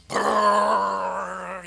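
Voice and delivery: a long, loud, rattling brrrr, mimicking the old metal sink's water pipes; loud pipe rattle. Preacher imitating the noisy pipes at the Amsterdam sink, loud rattle. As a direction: rumble it out like roaring plumbing. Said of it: "you know, really loudly".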